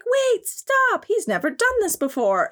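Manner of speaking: high, put-on girly alarm; high mock girl voice, startled; mock anime girl. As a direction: play a startled cartoon heroine. Voicing the girl in the hentai.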